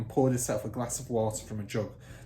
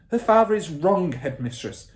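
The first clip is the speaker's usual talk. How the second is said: higher, louder firm teacher; teacher contradicting the headmistress; firm stand; 8 semitones higher, 9 dB louder than usual. Acted. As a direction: hold your ground. Miss Honey voiced.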